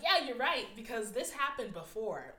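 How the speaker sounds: voicing her inner critic. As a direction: give in loudly to your own self-criticism. I'm like.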